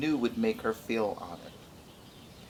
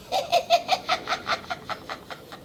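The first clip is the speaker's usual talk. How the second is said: breathless high giggle; a light airy titter; high laugh. Acted. Plant laughs after singing.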